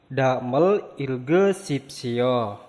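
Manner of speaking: Damel Ilge Sipsio said slowly and clearly, syllable by syllable; slow Korean read. Shown as a demonstration. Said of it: "Bacalah berikut ini".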